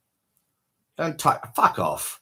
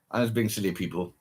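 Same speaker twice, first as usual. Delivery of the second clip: ordinary talk, nothing marked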